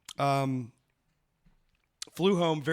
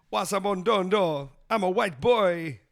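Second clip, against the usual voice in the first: slow, louder mock Jamaican accent; 5 semitones higher, 5 dB louder, slower than usual. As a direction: imitate a hammy Jamaican accent. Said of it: "doing that Jamaican accent thing".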